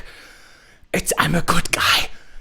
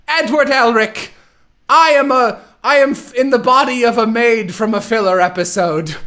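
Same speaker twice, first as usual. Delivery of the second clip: mock villain; 6 semitones higher than usual. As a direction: ham up a silly villainess. Voicing villain Dante addressing Edward.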